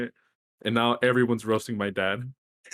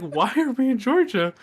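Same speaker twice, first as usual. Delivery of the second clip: much higher, incredulous squeak; baffled; 11 semitones higher, 4 dB louder than usual. Retold or imitated. Son mocking his lost dad, high incredulous echo. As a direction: echo the question in disbelief. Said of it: "I was like".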